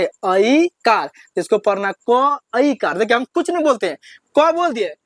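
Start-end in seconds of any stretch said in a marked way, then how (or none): 0.0-1.1 ऐ कार drawn out very slowly; teacher answering her own question slowly; slow spelling demo. Shown as a demonstration. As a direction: hold each sound of ऐ कार. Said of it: "क्या पढ़ते?".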